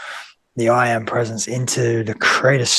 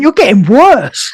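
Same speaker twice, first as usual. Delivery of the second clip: higher, louder mock telling-off; imitating a mum's complaint; exasperated mum; 8 semitones higher, 6 dB louder than usual. Mum to her tearful grown child, mock exasperation. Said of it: "My mum even said to me".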